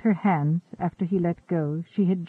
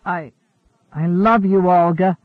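louder, halting declaration; earnest stammer, then pushing it out; 6 dB louder than usual. A narrator's reading. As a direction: stumble into a confession of love. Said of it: "Peter stammered".

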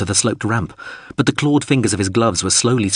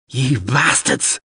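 higher, drawn-out angry cry; acted scream; 4 semitones higher, much slower than usual. A narrator's reading. Abandoned crewman curses the shuttle, drawn-out mild scream. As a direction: stretch the curse slowly, mock-screamed. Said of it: "screamed another voice".

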